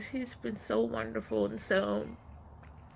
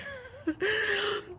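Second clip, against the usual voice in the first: short laugh. Real, not acted. Laughs at own overwhelm.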